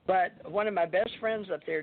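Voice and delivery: ordinary talk, nothing marked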